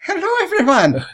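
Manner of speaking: high-pitched Scottish greeting; Scottish accent. Acted. [name]'s doctor character greets everyone in a high Scottish accent. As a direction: squeak the hello in a Scottish lilt. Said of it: "Scottish accent".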